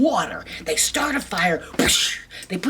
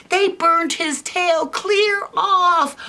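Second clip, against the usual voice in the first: high, slow, louder delight; excited storytelling; 12 semitones higher, 4 dB louder, slower than usual. A narrator's reading. Storyteller to kids, excited climax. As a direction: tell it with big excitement.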